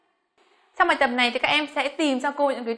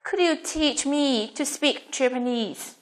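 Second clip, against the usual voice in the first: slow English, phrase by phrase; slow model reading; slower than usual. Shown as a demonstration. Teacher asking students to hear her read, slow model question. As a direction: read it slowly and carefully for learners. Teacher repeats sentence for listening.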